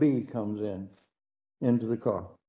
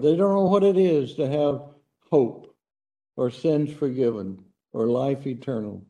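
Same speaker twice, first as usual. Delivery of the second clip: earnest pastoral prayer voice. Acted. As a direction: pray humbly to God for the lost. Closing prayer.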